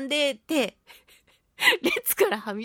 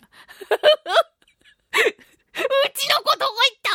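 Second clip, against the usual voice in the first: panicked cry; 13 semitones higher, 6 dB louder than usual. Retold or imitated. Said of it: "みたいな".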